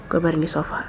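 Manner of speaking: hushed bedtime whisper; soft whisper. Acted. Putting a drunk friend to bed, whispering softly. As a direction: whisper it so she can sleep.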